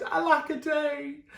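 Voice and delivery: mock lament. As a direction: wail it like a sad little dinosaur. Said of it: "And he cried".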